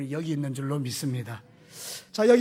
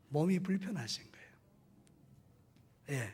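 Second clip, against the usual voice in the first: hushed whisper; 8 dB quieter, much slower than usual. Real, not acted.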